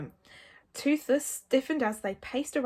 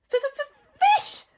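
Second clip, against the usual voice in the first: high, stuttering little dragon; squeaky creature insistence; Toothless; 8 dB louder than usual. A narrator's reading. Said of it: "said Toothless again".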